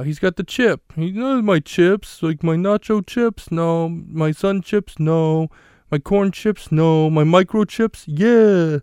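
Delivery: high, loud put-on sitcom voice; Three's Company character. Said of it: "impression of on Three's Company".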